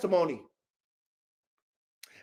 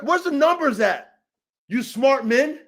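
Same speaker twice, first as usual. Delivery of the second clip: normal talking voice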